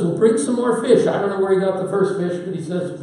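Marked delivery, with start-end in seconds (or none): 0.0-1.1 cheerful call. Retold or imitated. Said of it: "And Jesus says".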